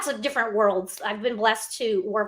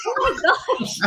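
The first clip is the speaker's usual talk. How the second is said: high, laughing retold reaction; laughing amazement; 10 semitones higher than usual. Actress retelling her reply to her co-star, laughing amazement. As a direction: relive the shock through a laugh.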